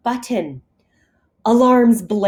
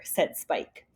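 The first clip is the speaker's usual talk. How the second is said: ordinary delivery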